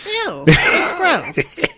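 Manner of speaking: slow, drawn-out grossed-out ew; disgusted. Real, not acted. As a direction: recoil at the picture he just painted. Reacting to imagined make-out.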